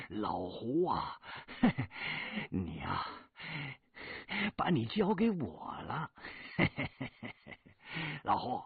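high, loud gloating with sly chuckles; menacing thug. Acted. Fatty gloating to Hu over the tied-up woman, menacing laugh. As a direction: leer like a knife-happy bully.

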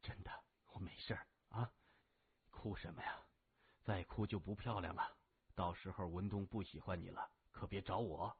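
low, soft, weakened voice; injured brother teasing tenderly. A narrator's reading. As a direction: quietly tease little sister out of crying. (低声温柔地逗妹妹)

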